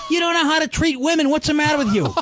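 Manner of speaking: high, indignant mock tirade; high-pitched scolding, retold for laughs; ballistic father. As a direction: imitate an outraged dad dressing down his son, pitch way up. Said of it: "He went ballistic".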